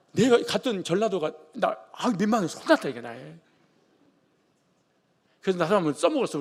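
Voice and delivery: normal talking voice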